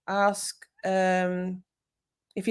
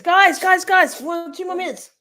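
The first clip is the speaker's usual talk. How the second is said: higher, louder calling out; 12 semitones higher, 6 dB louder than usual. Real, not acted. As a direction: grab everyone's attention. Calling out to viewers.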